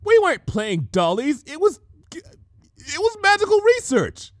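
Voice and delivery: high, loud flustered insisting; flustered defensive excuse. Acted. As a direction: blurt a cover story in a panic. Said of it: "with sudden defensive inspiration".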